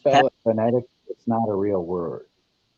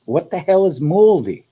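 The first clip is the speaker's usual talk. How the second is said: exasperated; 7 semitones higher, 7 dB louder than usual. Real, not acted. Exasperated at clue.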